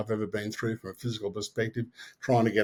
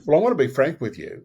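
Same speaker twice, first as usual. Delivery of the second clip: cocky, a little higher and louder; retold cockiness; 4 semitones higher, 6 dB louder than usual. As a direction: play it mildly full of yourself. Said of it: "I was pretty cocky".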